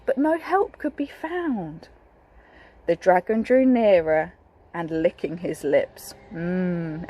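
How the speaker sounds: normal talking voice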